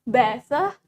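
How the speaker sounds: better with the R dropped; British pronunciation. Shown as a demonstration. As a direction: say "better" as "bet-tah". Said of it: "R-nya di British juga nggak jelas".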